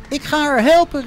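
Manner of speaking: high, loud call; eager shout. A narrator's reading. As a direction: Jet shouts she'll go help. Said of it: "riep Jet".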